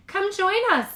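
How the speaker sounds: high, loud friendly call; cheerful invitation from the ice. A narrator's reading. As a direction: call her over across the pond. Millie calling from the ice.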